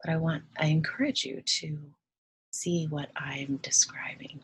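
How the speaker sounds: soft, unhurried meditation voice with long pauses; guided meditation. Acted. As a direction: ease the listener into relaxing, hushed and gentle.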